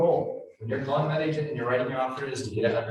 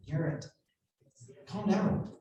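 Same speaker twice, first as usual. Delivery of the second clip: hushed, softer, higher condescending calm down; 4 semitones higher, 5 dB quieter, slower than usual. Retold or imitated. Broker soothing an upset agent, softly patronizing. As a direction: quietly talk him down like a patient adult. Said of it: "and he was like".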